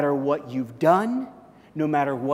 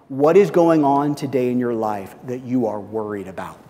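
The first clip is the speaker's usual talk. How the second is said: ordinary talk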